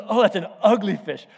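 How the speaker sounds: loud, theatrical pronouncement of doom; mock cursing. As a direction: play a dad laying a hammy curse. Dad curses fish in story.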